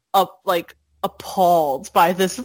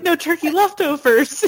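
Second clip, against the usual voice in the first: higher, mock-upset kid's lament; Ralph; 8 semitones higher than usual. Kid's voiceover mourning the ruined dinner, mock upset. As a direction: list each loss like an upset kid, pitched up. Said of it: "He's, like, so upset".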